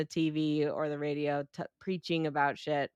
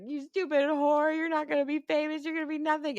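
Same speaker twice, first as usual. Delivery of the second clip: high-pitched, hammed-up scolding; high, sustained mock rant; mock tirade; 9 semitones higher than usual. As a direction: imitate a woman berating her rival. Imitates character berating her rival.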